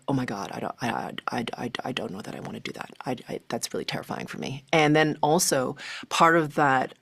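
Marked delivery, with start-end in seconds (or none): 0.1-4.6 dropping to a soft, frightened whisper; barely voiced, scared of losing herself; terrified whisper. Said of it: "that was terrifying".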